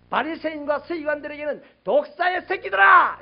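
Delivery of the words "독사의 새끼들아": drawn-out, high, loud shout; John the Baptist. Retold or imitated. John denouncing Pharisees and scribes, shouting. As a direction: hurl the insult with full force. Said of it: "이렇게 소리쳤단 말이에요".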